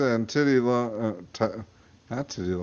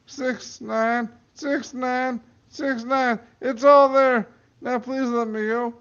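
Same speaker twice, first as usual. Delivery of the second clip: desperate high pleading; 11 semitones higher, 3 dB louder, slower than usual. Acted. Man reciting safe code.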